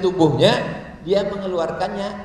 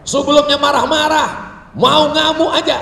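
booming, high angry rant; marah-marah; 8 semitones higher, 9 dB louder than usual. Acted. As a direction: thunder as if about to rampage. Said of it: "Perasaannya marah-marah itu Ustaz Somad". (menggelegar seperti mau ngamuk)

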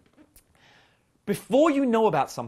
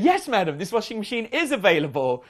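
higher, louder, politely formal assistant voice; prim shop assistant; 5 semitones higher, 6 dB louder than usual. Retold or imitated. Shop assistant telling the customer the machine is in stock, chirpy and formal. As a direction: play the eager shop clerk announcing good news.

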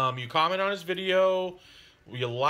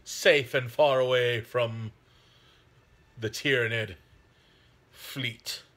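mock epic narration; 3 semitones lower, slower than usual. Dramatic narrative intro, "dramatic music.

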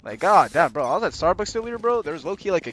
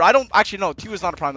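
ordinary talk

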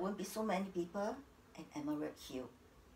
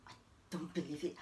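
low, hushed disbelief; stunned disbelief; 6 semitones lower, 7 dB quieter, faster than usual. Acted. Wife told her husband refuses her, in hushed shock. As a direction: say it softly, barely taking it in.

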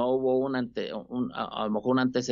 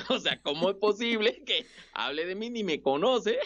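laughing disbelief; 6 semitones higher than usual. Retold or imitated. Laughing at his own offence.

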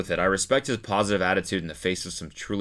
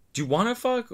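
mocking high voice; 13 semitones higher, 3 dB quieter than usual. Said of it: "imitating his questions".

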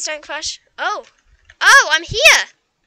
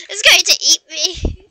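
laughing panic; 3 semitones higher, 7 dB louder, slower than usual. Real, not acted. Dragon chasing her.

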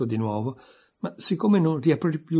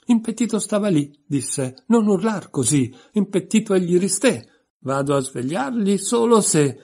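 raised, higher voice building toward a shout; nearly shouting; 8 semitones higher, 6 dB louder than usual. A narrator's reading. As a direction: recite it almost yelling, pitched up. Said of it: "alzò la voce fino quasi a urlare". (quasi urlando, voce alta e acuta)

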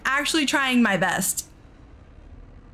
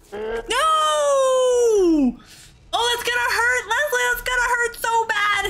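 panicked pleading; 9 semitones higher than usual. Real, not acted. Panic as the character is hit.

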